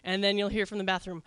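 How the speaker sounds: usual speaking voice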